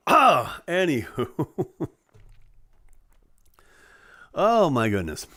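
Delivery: ordinary talk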